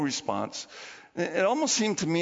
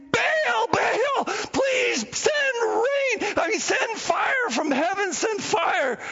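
desperate shouting; 13 semitones higher, much slower than usual. Retold or imitated. Bleeding prophets shout for fire, desperate. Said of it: "they're saying".

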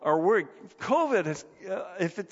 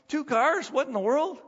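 mock astonishment; 7 semitones higher than usual. Mock astonishment at own story.

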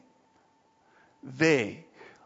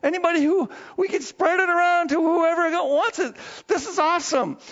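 much higher, loud thrilled announcement; joyful eagerness; 12 semitones higher, 3 dB louder than usual. Acted. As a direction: burst with joy about sharing good news. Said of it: "We're supposed to go".

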